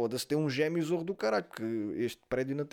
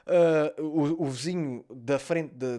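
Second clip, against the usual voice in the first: ordinary talk, nothing marked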